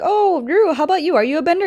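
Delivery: high-pitched put-on girl's question; acted friendly inquiry. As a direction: lift the voice high, politely curious. Acting Katara asking Ru politely.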